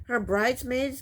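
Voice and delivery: usual speaking voice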